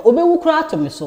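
blurting it out in a rush; excited outburst. Retold or imitated. Telling a friend how heavy it felt, excited and fast. Retelling mother's burden, voice jumps.

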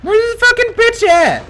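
very high, strained holler; high-pitched shouted demand; frantic yell. Real, not acted. Frantic yelling at the game.

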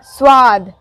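normal talking voice

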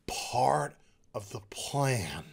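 slow, hushed, low murmur; mock-mysterious whisper. Reviewer mimicking the Monitor's cryptic answer, slow whisper. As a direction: draw it out like a cryptic sage.

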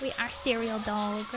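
low, soft declaration; quiet sinister pronouncement; ominous villain. Pretend. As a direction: state it low and menacingly. Plays the ominous serial dog.

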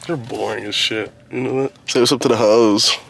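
ordinary delivery

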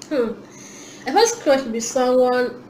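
normal talking voice

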